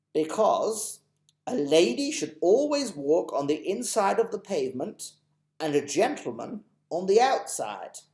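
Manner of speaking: put-on potter about like a polite old gent; lightly voiced, dotty old gentleman; fussy old gentleman. Mister Stink, gallant.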